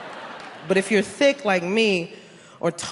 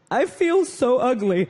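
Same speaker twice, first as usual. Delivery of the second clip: higher, slow mock whine; sister's self-pity; 4 semitones higher, slower than usual. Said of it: "My sister's very dark and sarcastic".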